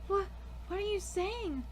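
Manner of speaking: usual speaking voice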